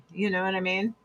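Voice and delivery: ordinary talk, nothing marked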